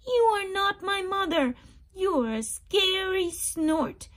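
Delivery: high, loud frightened chick; baby bird voice. Acted. Baby bird scared of the snort, high and loud. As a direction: cry it out at the scary snort. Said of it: "said the baby bird".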